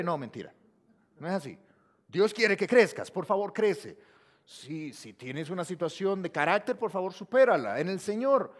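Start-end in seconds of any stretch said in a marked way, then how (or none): none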